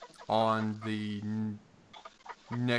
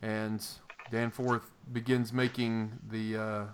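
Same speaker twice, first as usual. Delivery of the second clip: ordinary talk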